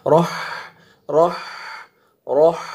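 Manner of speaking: full, thick ra with a clear breathy haa at the end; heavy raa. Shown as a demonstration. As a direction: say رح with a rounded, heavy r. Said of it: "را کو موٹا کر کے پڑھیں گے". (را کو موٹا کر کے)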